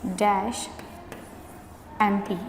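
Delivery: slow, word by word dictation. Shown as a demonstration. Teacher dictating blanks slowly to the class. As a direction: spell it out slowly for writing. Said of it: "ਨਾਲ ਨਾਲ ਬੋਲ ਵੀ ਰਹੀ ਹਾਂ".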